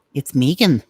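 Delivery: Irish accent, with Megan as "MEE-gan". Shown as a demonstration. Insisting on Megan as "MEE-gan", in an Irish accent. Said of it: "have to say with the Irish accent".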